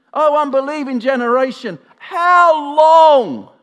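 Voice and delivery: loud, high exasperated yell, building to a bellow; hammed-up exasperated roar; frustrated shout. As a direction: cry out at the end of your patience. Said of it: "Can you hear the frustration?".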